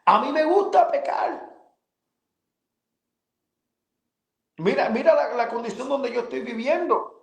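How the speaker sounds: high, raw pleading confession; retold anguished confession. Crying out his sins to God, anguished. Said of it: "le confesaba a él gritando".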